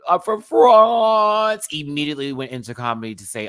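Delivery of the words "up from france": high, loud, stretched-out "France"; imitated comic entrance. Host re-enacting the queen's opening bit, drawn-out holler. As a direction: holler it slowly, dragging out "France".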